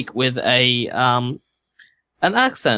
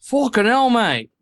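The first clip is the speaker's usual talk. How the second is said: higher-pitched Aussie exclamation; Australian accent; 8 semitones higher than usual. Shown as a demonstration. Player proving the Australian accent to the group, mock-outraged Aussie. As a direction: swear like an exasperated Australian. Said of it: "in the case of an Australian".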